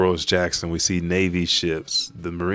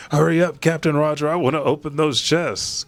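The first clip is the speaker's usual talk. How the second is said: eager pirate; 8 semitones higher than usual. Acted. Pirate pleading with his captain to open treasure, impatient. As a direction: whine to open the chests now.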